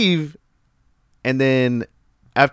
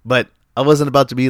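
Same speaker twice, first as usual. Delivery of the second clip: ordinary talk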